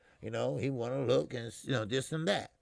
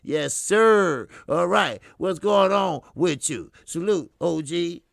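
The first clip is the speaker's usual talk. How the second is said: loud, drawn-out, punchy greeting; hyped shout-out; 3 semitones higher, 9 dB louder, slower than usual. Real, not acted. Host saluting a viewer in the chat, hollering. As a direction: holler a big welcome.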